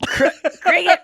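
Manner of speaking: laughing. Real, not acted. Laughing at the name.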